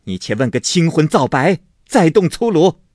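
loud, high scolding of her son; stern rebuke. A narrator's reading. Mother scolding her son for beating the maid, loud. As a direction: stop him with a sharp reproach. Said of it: "薛姨妈跑来进喝说".

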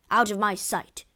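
loud, sudden snarl; angry snarl. A narrator's reading. Othello orders his wife away, loud angry snarl. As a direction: snap it out loudly in anger. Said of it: "he snarled".

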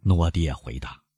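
usual speaking voice

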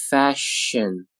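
"Fashion" slowly, syllables held; slow careful "Fashion"; drawn-out model. Shown as a demonstration. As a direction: stretch "Fashion" out for learners. Teacher models word slowly, then normally.

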